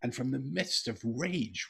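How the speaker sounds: ordinary delivery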